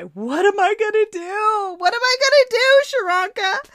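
high, loud wailing plea; imitating a sobbing woman's wail; crying. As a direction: cry it out in desperation. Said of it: "She would cry".

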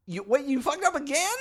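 high, rising disbelief; incredulous. Real, not acted. Co-host hears of another botched turkey entry, incredulous. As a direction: pitch it up into an incredulous question.

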